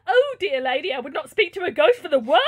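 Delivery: hammed-up servant in a fright; horrified maid. A narrator's reading. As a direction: refuse with melodramatic alarm. Maid recoils from ghost.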